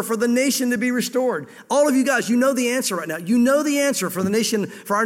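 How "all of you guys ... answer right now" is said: ordinary talk, nothing marked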